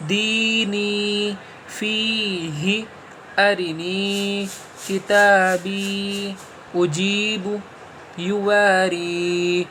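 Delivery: each word read very slowly, long vowels stretched; slow reading through. Shown as a demonstration. Teacher reading the whole line once for students, slow. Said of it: "ایک بار میں اسے پڑھ دیتا ہوں".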